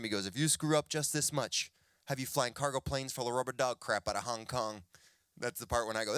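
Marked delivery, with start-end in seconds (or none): none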